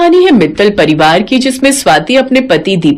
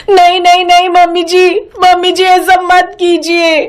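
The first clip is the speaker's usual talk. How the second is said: crying out in sleep; 6 semitones higher than usual. Acted. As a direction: beg desperately from a nightmare. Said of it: "जोर जोर से बड़बड़ाने लगती है".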